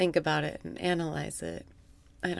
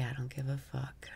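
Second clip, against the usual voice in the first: soft whispered release; 6 semitones lower, 13 dB quieter than usual. Shown as a demonstration. Whispering the phrase on the out-breath. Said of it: "Breathe out and say".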